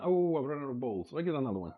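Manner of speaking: ordinary talk, nothing marked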